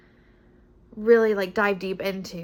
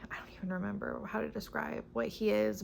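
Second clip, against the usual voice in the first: whispering; 9 dB quieter, faster than usual. Real, not acted. Aside while groping for words.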